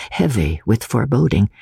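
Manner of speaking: ordinary delivery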